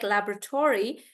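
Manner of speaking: laboratory as LAB-ruh-tor-ee; American pronunciation. Shown as a demonstration. Teacher repeating the American version, laboratory as LAB-ruh-tor-ee. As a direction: give laboratory its American "tory" ending. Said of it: "so they say it like".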